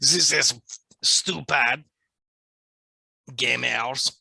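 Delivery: a hint of a sneering French accent; mock French accent. Mocking French language purists in a light fake French accent. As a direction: drop the words in a lightly put-on French accent. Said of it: "making fun of how French people can't speak English".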